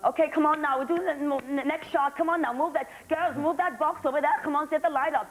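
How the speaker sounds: bossy German crew. Retold or imitated. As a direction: hurry the girls along like an impatient foreign crew boss. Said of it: "they go".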